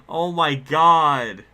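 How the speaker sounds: slow, loud, tearful "oh my god"; real teary disbelief; near tears. Real, not acted. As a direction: stretch it out, fighting tears. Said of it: "I'm gonna cry".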